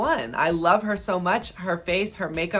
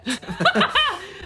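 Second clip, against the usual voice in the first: short high-pitched laugh; a quick amused giggle; 13 semitones higher than usual. Real, not acted. Laughs at own verdict.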